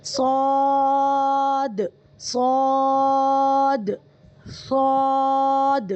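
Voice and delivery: صاد held very long, heavy emphatic s; slow model recitation; correct articulation. Shown as a demonstration. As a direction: stretch صاد slowly with a full heavy s from the right point. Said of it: "صحیح ادا نہیں ہو رہا اس کا مخرج".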